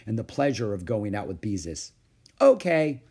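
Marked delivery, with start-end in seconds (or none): 2.4-3.0 high, loud okay; agreeing at last. A narrator's reading. Ramona agreeing to the library trip, loud and high. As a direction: give in with a pitched-up burst. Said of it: "she agreed at last".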